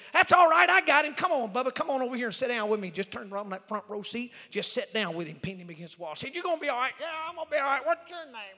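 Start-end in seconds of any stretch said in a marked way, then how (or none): 0.1-2.9 loud, high, hearty call across the plane; shouting to calm the drunk man down. Retold or imitated. 6.4-8.6 loud retold exchange. Loud retold chat with drunk.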